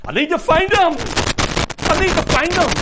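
very high, full-force yell; desperate shout. Retold or imitated. Peter running into the empty tomb, desperate high shout. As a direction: cry it out at the top of your voice.

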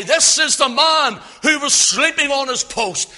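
loud, high accusing shout; preacher voicing an outraged sergeant; public denunciation. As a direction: call out the culprit before all the guards. Voices sergeant publicly shaming guard.

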